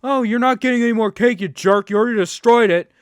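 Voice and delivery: loud, hurried imitation of an angry complaint; retold huffy outburst; mock mad. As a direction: mimic his indignant telling-off, rattled off loudly. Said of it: "then got mad when he said".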